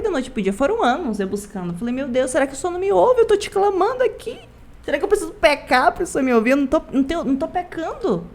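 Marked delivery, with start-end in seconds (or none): 1.9-8.3 high, fast pleading to God; young believer begging God to hear her, high and hurried; desperate prayer. Retold or imitated. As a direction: cry out to God, rushed and pitched up.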